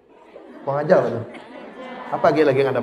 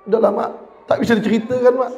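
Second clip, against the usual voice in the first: put-on child voice; 8 semitones higher, faster than usual.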